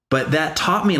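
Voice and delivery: ordinary talk, nothing marked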